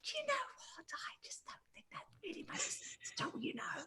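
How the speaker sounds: very soft, fully whispered argument; hushed mock-argument in a whisper. Shown as a demonstration. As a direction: whisper the retort playfully. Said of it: "saying it through whisper".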